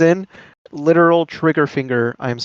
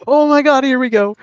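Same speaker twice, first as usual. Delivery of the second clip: excited; 10 semitones higher, 5 dB louder than usual. Real, not acted. Match suddenly starting on stream.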